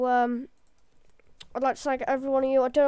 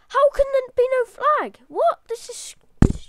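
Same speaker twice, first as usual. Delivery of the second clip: high, loud, incredulous outburst; shocked disbelief; 11 semitones higher, 8 dB louder than usual. Real, not acted. Gamer finding no flag on the moon, shocked disbelief. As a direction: cry out in utter bafflement. Finds the flag has vanished.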